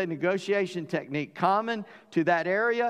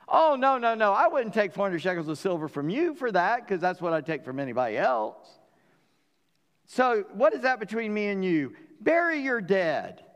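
mock protest; 5 semitones higher than usual. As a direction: protest too much, then wave it off. Ephron's mock refusal acted.

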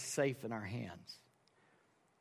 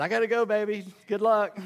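louder, higher mock goodbye; breezy brush-off; 6 semitones higher, 6 dB louder than usual. Pastor leaving his wife with the kids, breezy and hammed-up. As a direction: toss off a cheery excuse and escape.

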